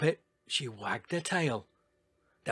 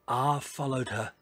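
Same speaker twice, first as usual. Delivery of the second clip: ordinary delivery, nothing marked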